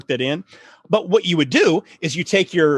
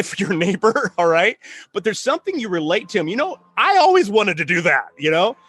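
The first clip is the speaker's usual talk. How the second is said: normal talking voice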